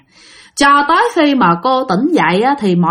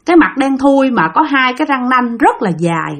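ordinary delivery

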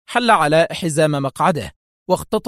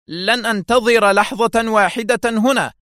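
high, louder outburst; angry exclaim; 8 semitones higher, 4 dB louder than usual. A narrator's reading. Russian doctor shouting before rushing to the door, angry exclaim. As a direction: refuse to wait another second. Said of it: "يهتف بالروسية".

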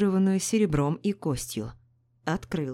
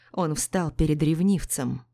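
usual speaking voice

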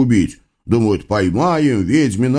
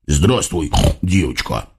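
lightly voiced low hog; a hint of oily porcine devil; fat hog devil; 7 semitones lower than usual. A narrator's reading. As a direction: greet a child in a lowered piggy voice. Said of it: "толстый хряк сориентировался".

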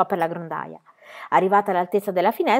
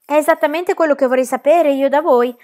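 courteous, higher and louder reply; most polite voice; 7 semitones higher, 7 dB louder than usual. A narrator's reading. Pippi answers the frightened ladies, overly polite. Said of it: "con la sua voce più cortese".

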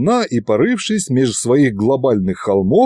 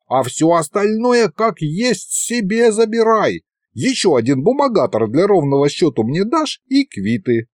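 put-on higher, wheedling old sorcerer; scheming old wizard; 7 semitones higher than usual. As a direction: talk the boy into a deal, sly old man. Wizard proposes a partnership. (хитрый старый колдун уговаривает, выше обычного)

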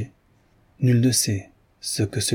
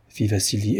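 usual speaking voice

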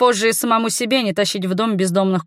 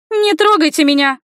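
put-on high, louder girlish protest; girl's squeal; 8 semitones higher, 5 dB louder than usual. A narrator's reading. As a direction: cry out as a frightened girl, not full scream. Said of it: "Девчонка визжит так".

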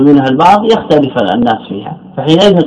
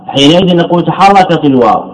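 normal talking voice

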